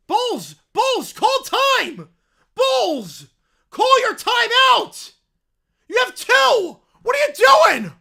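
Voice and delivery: loud, high, exasperated shouts at the TV; frustrated yelling. Real, not acted. Fan yelling at the Bucs to call their timeout, furious shout. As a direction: scream at the coach to take the timeout.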